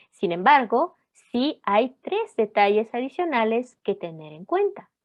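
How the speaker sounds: ordinary talk, nothing marked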